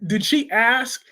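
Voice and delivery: normal talking voice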